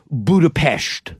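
"Budapest" slowly, with "pest" as "pesht"; slow, low native-style "Budapest"; Hungarian pronunciation. Retold or imitated. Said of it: "And he goes".

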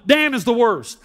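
normal talking voice

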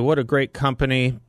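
usual speaking voice

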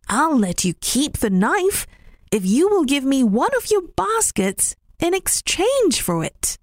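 high, friendly rabbit bargaining; generous rabbit voice. Acted. Rabbit proposing a trade to Mistress Puss, high and friendly. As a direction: offer the trade kindly, pitched high.

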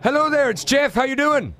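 high-pitched, put-on Irish phone greeting; attempted Irish accent. Prank-calling bar as its owner, attempted Irish accent. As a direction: pose cheerily with a shaky Irish accent. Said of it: "confident in your Irish accent".

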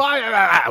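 high, loud mock protest noise; defensive squawk. Co-host mimicking colleagues getting corrected, defensive squawk. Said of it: "we get all defensive".

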